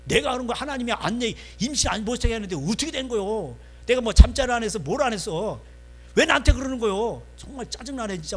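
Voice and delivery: high, exasperated self-defence; irritated, losing temper. Acted. Jacob defending himself to Rachel, high-pitched irritation. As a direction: argue back, fed up with being blamed. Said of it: "성질 내면서 하는 거야".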